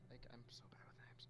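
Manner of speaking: very soft, fast whisper; barely audible, hurried confession; whispered aside. Real, not acted. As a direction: whisper an embarrassed admission under your breath. Embarrassed aside about botched name.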